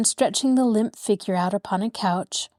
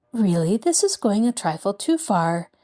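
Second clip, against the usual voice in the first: plain reading voice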